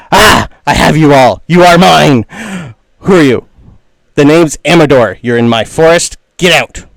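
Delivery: loud, theatrical little villain; blustering challenge. Acted. Dandelion swordsman confronting intruders in his forest, loud theatrical bluster. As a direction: declare it loudly, claiming your prisoners.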